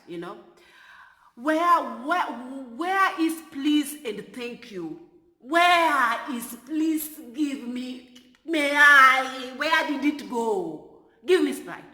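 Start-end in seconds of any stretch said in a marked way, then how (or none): 1.4-10.9 loud, higher and drawn out, scolding the missing please; loud mocking indignation; indignant mock outrage. 11.3-11.8 mocking the demand.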